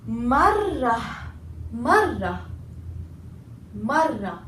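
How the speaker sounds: marra, marra, marra with doubled r; stressed double r. Shown as a demonstration. Teacher drills students on the doubled r. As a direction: repeat marra holding the rr. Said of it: "stress on the letter".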